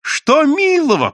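протяжно, громко и очень высоко; преувеличенно возмутись ради лести; подлизываясь. A narrator's reading. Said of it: "подлизывался Евсей". (подобострастный высокий возглас)

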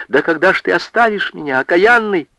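high, fast, exasperated plea; high-pitched, rushed lament; tearful outburst. A narrator's reading. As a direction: wail at him in exasperation. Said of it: "разревелась она".

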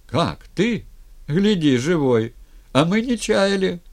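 high, excited exclamations; astonished, joyful crowd voices; noisy amazed greeting. A narrator's reading. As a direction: cry out in disbelief at a friend's return. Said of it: "Зашумели от берега".

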